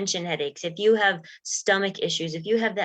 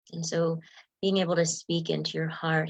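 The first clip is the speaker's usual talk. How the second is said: ordinary talk, nothing marked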